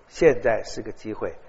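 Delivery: ordinary delivery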